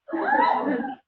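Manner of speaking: a high shriek of laughter. Real, not acted. Laughing at her own retelling, high shrieking laugh. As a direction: burst into a squealing, shouted laugh.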